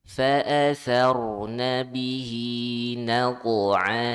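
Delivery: loud melodic tajwid chant; Quran recitation. Shown as a demonstration. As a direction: recite it out full for the class to copy. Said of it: "Kita ulang".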